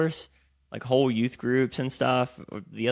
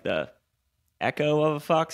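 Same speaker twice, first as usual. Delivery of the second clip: usual speaking voice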